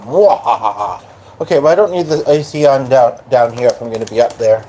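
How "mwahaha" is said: slow evil cackle; villainous mwahaha, drawn out; mad scientist. Pretend. Restless streamer's evil laugh.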